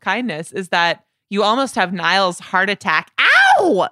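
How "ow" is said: sudden high-pitched ow; high yelp. Retold or imitated. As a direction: yelp it like the singer. Imitating the singer's yelp.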